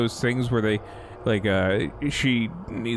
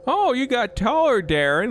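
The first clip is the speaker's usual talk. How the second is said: high mock surprised voice; 11 semitones higher than usual.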